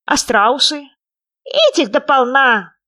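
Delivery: high, loud little frog; tiny creature piping up. A narrator's reading. Frog describes Australia to hare.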